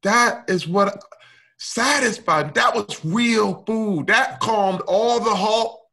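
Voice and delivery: recalling the relief of being loved, choked up; moved. Real, not acted. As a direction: let it rise and stretch out, overwhelmed. Overwhelmed, emotional recollection.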